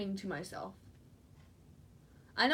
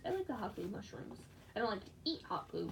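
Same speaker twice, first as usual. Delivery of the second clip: normal talking voice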